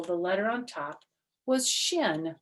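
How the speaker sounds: ordinary delivery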